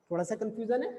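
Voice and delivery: normal talking voice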